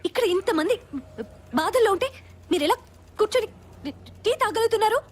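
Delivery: indignant outburst, pitched well up; shouting. Acted. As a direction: shout at them in outrage. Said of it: "ఎందుకు అరుస్తున్నావు madam?".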